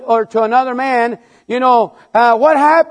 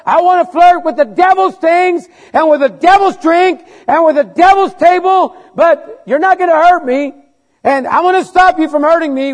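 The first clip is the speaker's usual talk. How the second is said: defiant boast; 7 semitones higher, 5 dB louder than usual. Pretend. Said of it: "to say".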